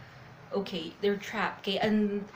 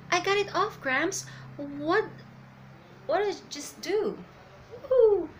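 acted amazed delight, pitched up; rejoicing; 6 semitones higher than usual. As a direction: voice a character's surprised celebration. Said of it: "Luffy is rejoicing".

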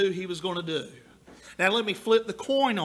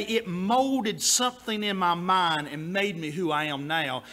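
normal talking voice

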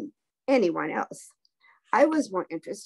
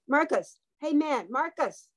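higher-pitched call, only mildly loud; urgent calling; 6 semitones higher than usual. A narrator's reading. Damien to his unresponsive friend in the car, urgent calling. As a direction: try to rouse a friend, lightly acted. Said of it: "he said loudly".

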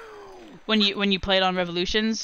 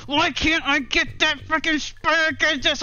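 higher, half-acted gamer rage; retold frustration; 8 semitones higher than usual. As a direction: replay the annoyance of dying again. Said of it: "I was so mad".

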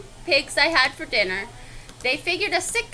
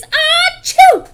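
loud, high "ah-choo" explosion; huge acted sneeze; 13 dB louder than usual. Wolf at stick house, huge acted sneeze. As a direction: fake an enormous sneeze. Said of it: "I sneezed a great sneeze".

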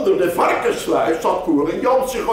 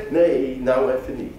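usual speaking voice

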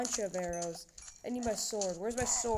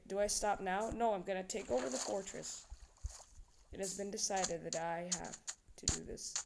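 usual speaking voice

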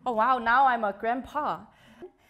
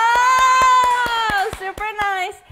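high, loud burst of delight; excited outburst to a nice comment; delighted exclamation; 9 semitones higher, 12 dB louder, much slower than usual. Real, not acted. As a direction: squeal it out with joy.

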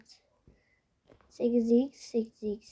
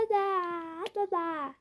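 panicked babble; 7 semitones higher, 4 dB quieter, much slower than usual. Real, not acted. Panicked nonsense while running.

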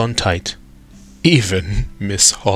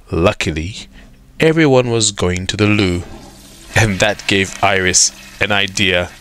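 ordinary delivery, nothing marked

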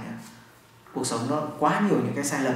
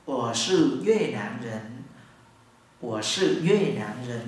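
slow demo; 3 semitones lower, slower than usual. Shown as a demonstration. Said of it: "nói rõ luôn".

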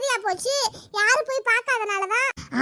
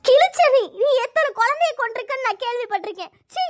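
angry scorn; 2 semitones higher, 4 dB louder than usual. Acted. Patient accusing the midwife, angry scorn. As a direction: throw the accusation in her face.

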